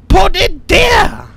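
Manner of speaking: loud, slow, stretched-out order; frustrated shout. Real, not acted. Player failing to place the item, slow exasperated shout. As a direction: yell it word by word, drawn out. Fed up with game controls.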